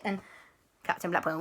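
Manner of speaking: usual speaking voice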